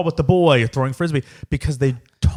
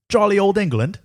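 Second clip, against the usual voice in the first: hammed-up British accent, pitched high; 6 semitones higher than usual. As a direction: put on a jokey British lilt. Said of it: "Should I go with the British accent".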